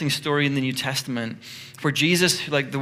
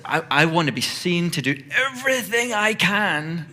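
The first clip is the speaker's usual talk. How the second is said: higher, straining eagerness; stressed striving; 4 semitones higher, 3 dB louder than usual. Acted. Martha wanting to be seen serving, mock stressed striving. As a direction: play someone frantic to be noticed, hammed up. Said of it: "she's stressing about it".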